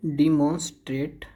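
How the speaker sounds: डिमॉन्स्ट्रेट with "dee" and a full "mon"; wrong pronunciation. Shown as a demonstration. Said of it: "ना डिमॉन्स्ट्रेट है".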